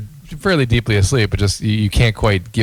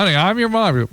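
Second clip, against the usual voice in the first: higher mock voices; 9 semitones higher than usual. Voicing wife and sleepwalking daughter.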